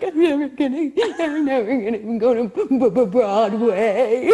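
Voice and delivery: hammed-up heartbreak over a lost shot; dramatic despair. Sure she blew her Broadway chance, mock despairing wail. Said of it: "Meanwhile, I'm like".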